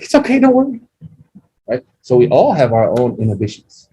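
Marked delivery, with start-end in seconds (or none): 0.0-0.8 wife reassuring. Retold or imitated. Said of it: "And my wife's like".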